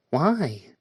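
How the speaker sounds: quick high chirp of a question; almost chirped. A narrator's reading. Joanna asking the officer why, high chirp. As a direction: pop the word up high like a bird. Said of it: "Joanna almost chirped".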